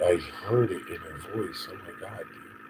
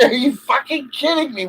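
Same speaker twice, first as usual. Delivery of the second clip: high, loud, hitting kidding hard; loud exasperated disbelief at the absurdity; pitched-up cry of disbelief; incredulous shout; 10 dB louder than usual. Real, not acted. Outburst of disbelief.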